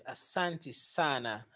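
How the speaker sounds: "Asante sana" said slowly, clear s in Asante; slow careful Swahili. Shown as a demonstration. Corrected by a viewer, slow careful Asante sana. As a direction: spell out Asante with a clean s. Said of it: "She corrected me that it's Asante sana".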